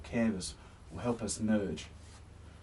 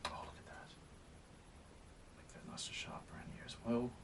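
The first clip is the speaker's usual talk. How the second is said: whispered instruction while painting; softly, barely voiced; 14 dB quieter than usual. Real, not acted.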